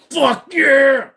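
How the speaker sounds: high, loud thrilled shout; mock wild enthusiasm, drawn out; gung-ho whoop. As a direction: yell it like an overexcited first-timer. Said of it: "It's the ones that go".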